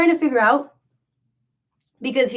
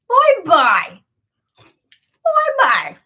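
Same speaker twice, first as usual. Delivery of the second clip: high sing-song mock farewell, much higher and louder; mock-weepy goodbye, pitched way up; mocking bye-bye; 7 semitones higher, 8 dB louder, much slower than usual. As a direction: wave him off with a jeering, teary-sounding bye-bye. Mocking the ex leaving.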